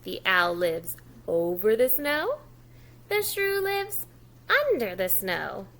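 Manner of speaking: "over" and "under" stressed in the sentences; modelled sentences. Shown as a demonstration. Teacher modelling the owl and shrew sentences. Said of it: "You try".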